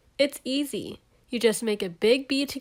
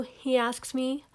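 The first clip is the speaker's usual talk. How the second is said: ordinary delivery, nothing marked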